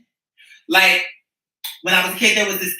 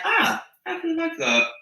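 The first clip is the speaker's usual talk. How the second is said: imitating own thought; 8 semitones higher, 5 dB quieter, slower than usual. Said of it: "you told me enough where I'm like".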